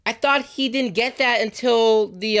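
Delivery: high-pitched, startled realisation; excited surprise. Real, not acted. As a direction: blurt it out, caught off guard. Surprised by the whistle's early appearance.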